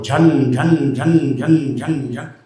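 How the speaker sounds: slow ringing jingle sounds; clinking coins imitated. Gold showering on the old woman, slow jingling clinks. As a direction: ring out each clink slowly like falling gold.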